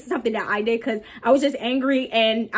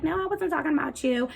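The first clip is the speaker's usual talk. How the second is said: high, soft imitation of her backing down; mock denial; 4 semitones higher, 5 dB quieter than usual. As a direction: imitate her in a small, higher voice. Imitates confronted girl denying it.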